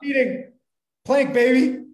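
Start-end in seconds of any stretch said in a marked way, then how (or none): 1.0-1.8 loud, high coaching call; coach yell. Real, not acted. Friend urges him through his plank with a loud, high coach's call. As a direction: push her through the plank like a trainer.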